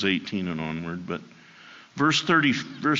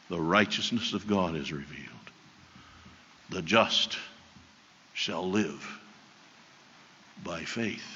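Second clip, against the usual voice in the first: solemn reading; 5 semitones lower, 7 dB quieter than usual. Acted. Preacher reads key verse.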